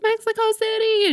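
high, excited yell from the crowd; fan shouting answer. Retold or imitated. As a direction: call out your city proudly.

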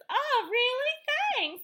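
high, loud mock delight; hammed-up, squealy gratitude; flattered gushing. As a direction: imitate a woman thrilled by a compliment. Retold flattered reply to compliment.

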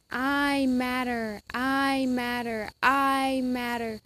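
slow, soft self-affirmation. Shown as a demonstration. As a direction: say it slowly to yourself. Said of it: "do you hear these words in it".

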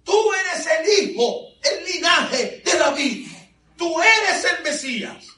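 high, loud and slow proclamation; the woman hailing the Messiah; slow, high cry of faith. Retold or imitated.

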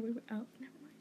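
hushed whisper; whispered so the caller won't hear. Real, not acted. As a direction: drop to a whisper mid-call. Kids whispering during prank call.

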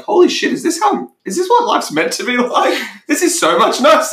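high, laughing disbelief; amazed realisation. Retold or imitated. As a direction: gasp it out, laughing in wonder. Imagined realisation of thriving.

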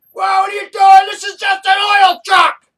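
high, loud startled yell; big hammed-up movie-cop shout. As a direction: shout at the guy stopping the truck. Acting out the movie cop scene.

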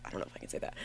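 hushed nervous aside; whispered aside. Real, not acted. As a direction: whisper it to yourself. Worried aside under breath.

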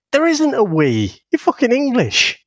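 high-pitched exasperated retort; pissed off. Retold or imitated. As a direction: challenge him as if talking back to the radio. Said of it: "what pisses me off".

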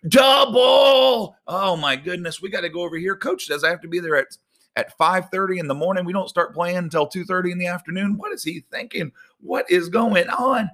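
high-pitched frustrated complaining; exasperated player rant. Retold or imitated. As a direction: rant like a fed-up sports parent. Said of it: "you're like".

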